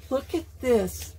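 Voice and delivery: ordinary delivery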